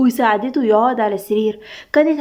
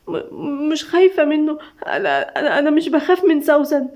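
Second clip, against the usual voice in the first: halting, tearful stammer, pitched up; sobbing, broken speech; 3 semitones higher than usual. A narrator's reading. Doaa denying fear to Khaled, sobbing brokenly. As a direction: break the words up as if crying. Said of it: "بشقه وكلام متقطع بسبب العياط".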